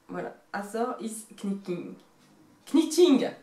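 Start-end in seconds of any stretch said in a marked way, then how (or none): 2.7-3.3 Marseille-accented English. Shown as a demonstration. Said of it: "Avec ce petit accent, euh, marseillais".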